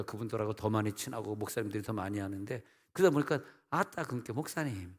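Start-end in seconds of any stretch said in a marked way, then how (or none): 3.7-4.9 softly imitated Jeolla dialect. Jeolla pastors praising today's sermon, quietly imitated Jeolla dialect. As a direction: lightly mimic an admiring Jeolla churchgoer. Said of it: "내가 좀 전라도 편향 쪽이라서".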